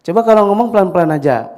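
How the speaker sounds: high, loud acted parental nag; loud scolding. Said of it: "kencang juga".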